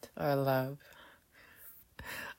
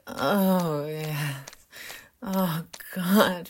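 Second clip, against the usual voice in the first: loud moans; 8 dB louder, much slower than usual. Acted. Moaning during act.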